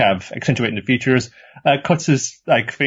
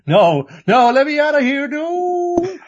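mock trapped plea; slower than usual. Trapped girl banging on the screen, hammed-up wailing plea. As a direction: wail higher and higher in make-believe panic. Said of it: "there's banging on the screen".

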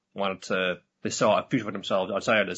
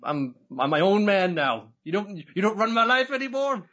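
much higher, louder, emphatic declaration; defiant young man; 8 semitones higher, 4 dB louder than usual. Retold or imitated. Son telling his mother he'll choose his own path, high and loud. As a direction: voice the son standing up for himself, loudly and high.

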